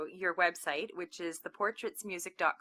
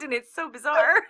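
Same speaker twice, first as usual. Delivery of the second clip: laughing; 8 semitones higher, 6 dB louder than usual. Real, not acted. Amused at accent likeness.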